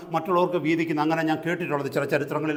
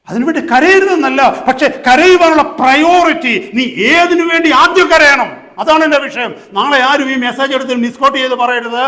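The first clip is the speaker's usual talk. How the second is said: loud, high pulpit voice; fervent preaching; 9 semitones higher, 12 dB louder than usual. Real, not acted. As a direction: press the point on the congregation loudly.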